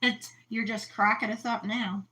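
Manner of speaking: ordinary delivery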